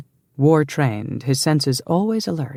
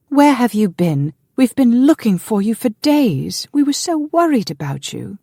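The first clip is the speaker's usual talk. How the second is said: high-pitched, worried woman's welcome; relieved excitement; 10 semitones higher, 5 dB louder than usual. A narrator's reading. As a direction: pour out worry and relief in a high voice. Sister-in-law greets missing man excitedly.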